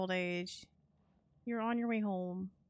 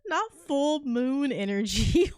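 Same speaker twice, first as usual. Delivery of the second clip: laughing; 4 semitones higher, 7 dB louder, slower than usual. Real, not acted. Cracking up, loud slow.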